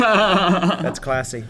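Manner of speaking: sarcastic. Real, not acted. Sarcastic reply to crude joke.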